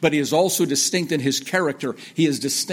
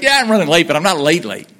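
higher, louder defensive reply; loud protest; 3 semitones higher, 9 dB louder than usual. Acted. Answering someone asking about running late, loud protest. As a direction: insist loudly, punching "not late, late".

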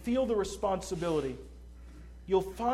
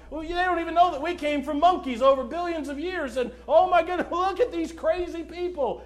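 raised, incredulous scoffer impression; mocking laughter; 8 semitones higher, 7 dB louder than usual. Scoffer ridiculing Christians over evolution, imitated mocking laughter. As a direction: jeer at them, breaking into mock laughter. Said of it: "somebody starts laughing and said".

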